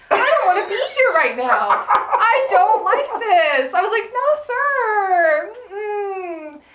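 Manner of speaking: high, loud whine of refusal; horrified protest. Retold or imitated. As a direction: wail your objection, half in mock distress. Retold horrified refusal while watching.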